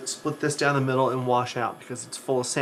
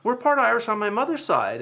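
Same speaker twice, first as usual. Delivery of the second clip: mother's breezy voice, pitched much higher; imitating mother; 10 semitones higher than usual. Narrator imitates mother claiming Irish roots, pitched much higher. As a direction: play the mother airily changing her story. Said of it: "And she's like".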